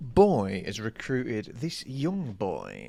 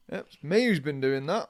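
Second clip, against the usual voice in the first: usual speaking voice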